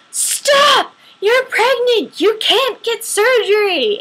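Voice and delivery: high, loud, urgent yell to stop her; frantic yell. Retold or imitated. Doctor running up to stop the patient: a high, loud yell. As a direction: shout her to a halt before surgery, high and loud.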